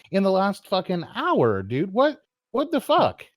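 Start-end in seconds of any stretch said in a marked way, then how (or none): 1.9-3.2 high, slow disbelief; slow, high exasperated outburst; incredulous what the fuck. Real, not acted. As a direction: drag it out in disbelief. Confronting a streamer's insults.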